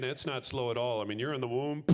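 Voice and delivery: usual speaking voice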